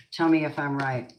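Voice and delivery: usual speaking voice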